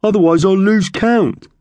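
very high, loud bird voice; magpie retort. Acted. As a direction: squawk it back insistently. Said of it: "Thug retorted".

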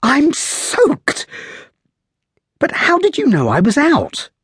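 put-on light, high, lively young lady; playful high girl's voice, teasing; young woman's voice. A narrator's reading. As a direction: raise the voice into a spirited young woman. Girl voiced in story.

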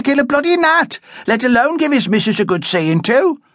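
high, coarse mocking jibes; spiteful young woman. A narrator's reading. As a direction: rub salt in the wound. Lily mocking Amy further.

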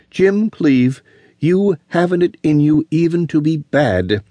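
sting him with every word. A narrator's reading. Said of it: "she went on stingingly".

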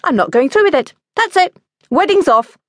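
high, loud, defiant outburst; sudden declaration. A narrator's reading. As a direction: call it all off on the spot. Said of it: "declared my mother suddenly".

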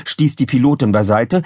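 ordinary delivery, nothing marked

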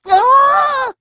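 very high, loud scream; long high shriek of fright. A narrator's reading. Julie as Eric tumbles into air, high scream. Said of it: "Julie screamed".

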